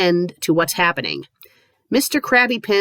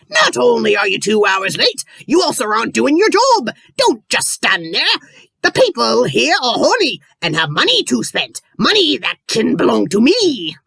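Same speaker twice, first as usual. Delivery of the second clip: loud, higher gruff pirate voice; 4 semitones higher, 4 dB louder than usual. Acted. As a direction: bark it like a greedy pirate captain. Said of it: "I'll try to do the pirate voice".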